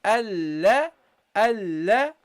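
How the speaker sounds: alla, a joined to lam; teacher linking hamza to lam; careful recitation. Shown as a demonstration. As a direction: sound out alla clearly. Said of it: "attach it to the lam".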